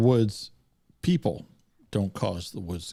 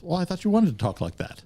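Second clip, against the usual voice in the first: Igor's innocent reply; 9 semitones higher than usual. Retold or imitated. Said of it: "He goes".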